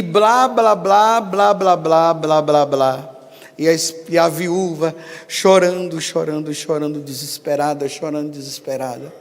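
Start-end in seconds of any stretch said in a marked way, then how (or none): 0.0-3.1 dismissive blah blah. Retold or imitated. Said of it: "o padre começou a mentira".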